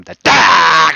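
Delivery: slow, drawn-out shout. Real, not acted. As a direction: bellow it out slowly. Yelling out a declaration.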